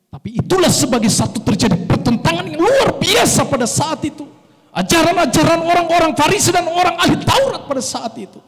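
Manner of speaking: loud, high-pitched sermon climax; forceful preaching. Real, not acted. Preacher tells congregation of the clash with Pharisees, loud and impassioned. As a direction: drive the point home at full pulpit force.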